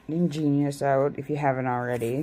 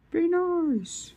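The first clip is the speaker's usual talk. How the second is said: mock high scold.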